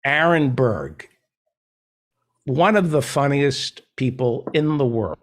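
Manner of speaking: formal introduction. Shown as a demonstration. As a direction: introduce the guest like a host. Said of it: "let me give you a proper introduction".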